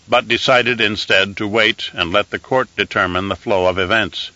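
usual speaking voice

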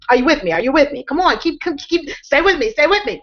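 usual speaking voice

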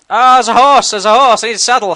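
high, loud thrilled yell; loud squeal of delight at a horse; excited shout. Real, not acted. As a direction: call out like you found treasure.

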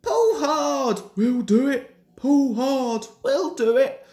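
high, alternating chugged chant; puffing together. A narrator's reading. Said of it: "they puffed together".

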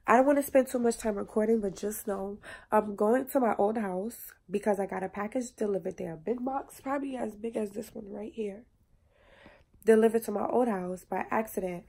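ordinary delivery

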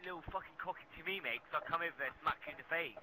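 fast, heated threat; angry threat. Real, not acted. Gamer threatening to come over to the other player, angry. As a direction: rattle off the threat, starting loud and high.

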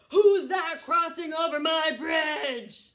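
high, very loud, slow shout; angry troll. Acted. Troll challenging the biggest goat on his bridge, shouting. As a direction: roar each word as the troll.

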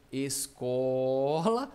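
"Escola" with "co" greatly stretched; stressed penultimate syllable. Shown as a demonstration. Teacher shows pupils the tonic syllable, stressed long. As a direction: hold the "co" of "Escola" very slowly. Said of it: "cuja sílaba tônica se encontra na penúltima".

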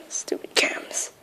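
frustrated whisper. Real, not acted. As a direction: whisper it under breath. Muttered frustration while hiding.

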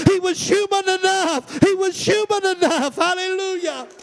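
high shouted climax; preaching at full force. Acted. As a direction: ring it out as the peak of the sermon. Sermon climax.

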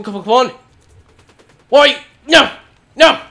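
usual speaking voice

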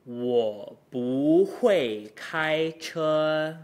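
Wo bu hui kai che drawn out very slowly, hui stressed; exaggerated slow take. Shown as a demonstration. Teacher modelling the sentence at drill speed, very slow. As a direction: hold each syllable, tones exaggerated. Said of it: "exaggeration and slow speech".

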